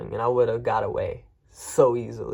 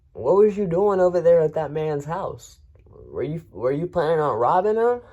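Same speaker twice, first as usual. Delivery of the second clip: mock cop interrogation; 5 semitones higher, 5 dB louder than usual. Cops interrogating.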